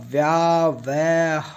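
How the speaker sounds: व्यावहारिक syllable by syllable with pauses; व्यावहारिक very drawn out; sounded out slowly. Shown as a demonstration. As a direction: break व्यावहारिक into व्या, व, हा, रिक. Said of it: "तो ये बनेगा".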